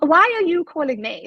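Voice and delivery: pitched well up in disbelief; baffled, suspicious question; incredulous. Retold or imitated. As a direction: ask it like you can't believe they rang. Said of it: "and I was like".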